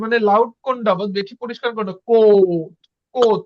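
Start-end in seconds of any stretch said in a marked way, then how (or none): 2.1-3.4 কোট said loud with a clear ও; teacher demoing a stressed কোট. Shown as a demonstration. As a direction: hit the ও of কোট. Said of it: "ও কিন্তু বেশি জোর পড়তেছে".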